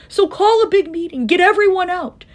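high, insistent call; urgent calling. A narrator's reading. Horton tells the mayor to gather everyone, calling urgently. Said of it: "Horton called".

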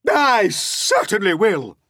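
high, loud snap; Aunt Spiker snapping. Acted. Aunt Spiker agreeing to beat James, high and loud. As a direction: snap back eagerly. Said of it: "Aunt Spiker snapped".

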